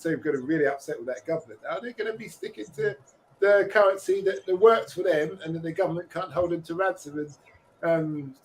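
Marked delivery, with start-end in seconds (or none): none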